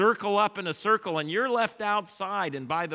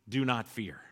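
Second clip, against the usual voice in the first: softer, lower comfort; hushed; 7 semitones lower, 7 dB quieter than usual. Acted. Jesus calming frightened disciples, hushed and low. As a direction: drop it to a gentle hush. Softly voiced Jesus line.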